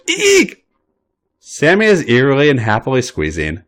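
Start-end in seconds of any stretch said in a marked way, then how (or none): 0.1-0.6 sudden very high eek of surprise; high squeal. A narrator's reading. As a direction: yelp as if tickled. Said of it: "squealing giggles".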